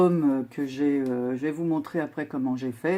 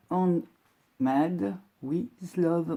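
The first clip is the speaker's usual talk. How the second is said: slow careful English with a French accent; 2 semitones higher, much slower than usual. Shown as a demonstration. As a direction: spell out each English word slowly, in a French accent. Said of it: "j'ai pour l'accent, très anglais".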